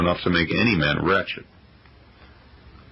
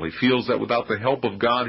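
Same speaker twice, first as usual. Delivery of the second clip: ordinary talk, nothing marked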